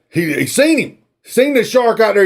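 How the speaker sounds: usual speaking voice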